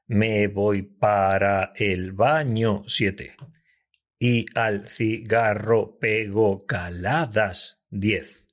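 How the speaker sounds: very slow, syllable by syllable; counting syllables slowly. Shown as a demonstration. Host counting the syllables of each phrase, drawn out slowly. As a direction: spell it out slowly. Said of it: "tiene menos sílabas".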